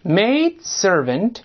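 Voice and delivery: maid servant drawn out slowly, rising on maid; teacher reading a new phrase slowly aloud; slow model reading. Shown as a demonstration. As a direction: spell each sound out for the class. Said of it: "咱们第三十六课出现过".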